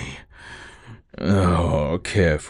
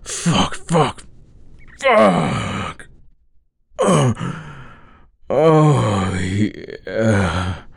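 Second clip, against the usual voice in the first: much higher, louder moaning curses; climax moans; 6 semitones higher, 4 dB louder, much slower than usual. Acted. Reaching climax with his partner, higher, louder moaning. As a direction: let go into the climax.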